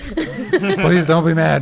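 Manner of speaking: laughing through a slow please. Real, not acted. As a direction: plead while laughing, slowly. Laughing at the apology.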